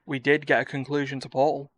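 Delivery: ordinary delivery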